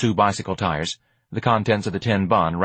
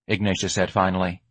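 plain reading voice

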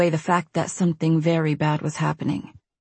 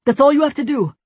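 pleading; 8 semitones higher, 5 dB louder, faster than usual. Acted. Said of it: "his voice pleading".

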